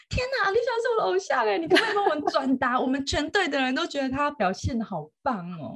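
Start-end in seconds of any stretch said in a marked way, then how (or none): 0.1-2.8 much higher, excited fangirl gush; star-struck mother asking a favour; gushing fan. Retold or imitated. Other team's mother gushing about Alicia.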